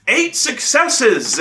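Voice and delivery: high, slow mock player protest; insistent protest. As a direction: imitate a player insisting, drawn out.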